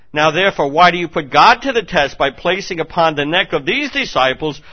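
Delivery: high, loud fiery preaching; impassioned pulpit roar; full-force preaching. Acted. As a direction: thunder it at the crowd.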